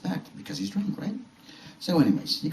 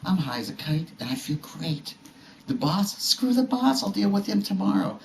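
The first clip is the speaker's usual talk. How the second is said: high-pitched, carefree crowing; imitated stoned elation; 13 semitones higher than usual. Stoned driver alone in traffic, elated and carefree. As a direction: mimic a high driver brushing off his troubles.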